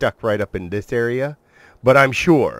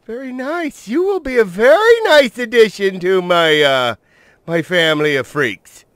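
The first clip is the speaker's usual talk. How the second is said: mock villain; 10 semitones higher, 7 dB louder than usual. Addressing the tamed dino.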